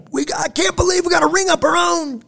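high-pitched imitation of a man yelling; strained mock yell; retold yell. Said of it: "he just yells".

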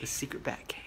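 secret hushed whisper. Pretend.

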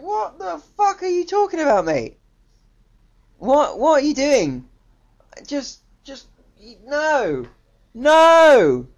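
high-pitched, incredulous protest; exasperated outburst. Acted. Reviewer talking back to the actor's performance, high exasperated outburst. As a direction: protest in high disbelief, building to a loud final NO.

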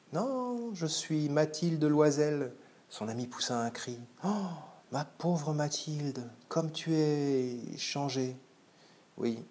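high, slow exclamations of the friends; acted dialogue. Madame Forestier meeting Mathilde again, high and slow. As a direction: voice the shocked reunion.

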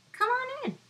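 high squeaky little fly; a tiny insect inviting guests. Acted.

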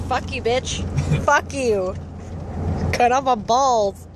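hammed-up grumpy pet voice; voicing the grumpy cat. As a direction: play the cat cursing the owner. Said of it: "He's like".

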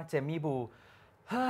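"เฮ้ย" breathed out like a sigh; sighed name ending. Shown as a demonstration. Co-host showing how to pronounce a star's surname, "เฮ้ย" sighed out. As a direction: say "เจมี่บู", then let "เฮ้ย" out as a sigh. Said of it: "อันนั้นออกการถอนหายใจนะพ่อนะ".

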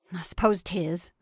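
ordinary delivery, nothing marked

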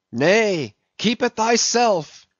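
high, slow fanatic's cry; cried out. A narrator's reading. Gabriel crying to Ahab to keep the dead man's letter, high and slow. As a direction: hurl it at him like a curse. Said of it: "cried Gabriel to Ahab".

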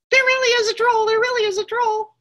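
very high, loud panicked squeal; scared little goat. Acted. Littlest goat warning his brothers, high and panicked. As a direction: squeal the alarm. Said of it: "was so scared".

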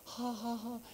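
soft, high ha-ha-ha; imitated laughter. Man still laughing mid-prayer, soft high mimed laugh. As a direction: trail a put-on airy laugh. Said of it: "I don't wanna just a little laughing".

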